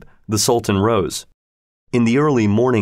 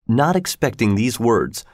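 ordinary delivery

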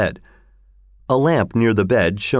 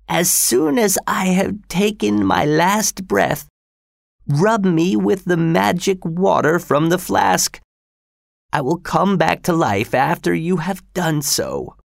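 put-on old father voice; 8 semitones higher than usual. A narrator's reading. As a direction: voice the frail father, rising.

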